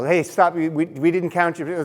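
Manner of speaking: half-yell as if stopping someone leaving; imitated yell. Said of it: "there was someone yelling down the hall".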